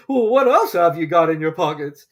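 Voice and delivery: pompous bird asking; dodo voice. Acted. Dodo turning to Alice, loud and grave.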